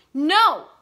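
high loud cry; wife refusing husband, shouting; shouted no. Acted. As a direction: yell the refusal. Vicky refuses.

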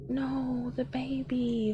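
hushed whisper of dread. Real, not acted. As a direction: whisper it under your breath, shaken. Frightened whisper in horror game.

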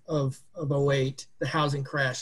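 ordinary talk, nothing marked

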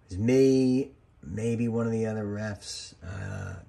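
ordinary delivery

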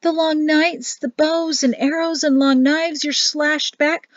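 high, breath-held wonder; moved wife. A narrator's reading. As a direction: list it in a high, emotional voice. Wife moved by husband's deeds.

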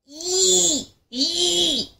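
high squealing; piglet squeals. Acted. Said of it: "squealed the piglets".

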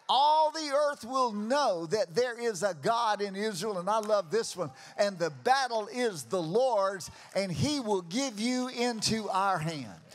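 loud, ringing sermon climax; preaching at full force. Acted. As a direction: preach it out to the crowd.

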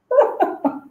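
short, high laugh; amused laugh. Real, not acted. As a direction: burst into a brief high-pitched laugh. Amused at question about mistakes.

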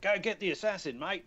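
high Australian audition read; Australian accent. Retold or imitated. Reading lines cold for the interviewers, high Australian accent. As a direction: call it out in high, broad Australian. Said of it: "in my Australian accent".

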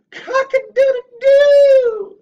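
high, loud, drawn-out crowing shout; rooster crow. Retold or imitated. Mocking a rival who plays the rooster, in a loud, high rooster crow. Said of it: "Pretending to be the fucking rooster".

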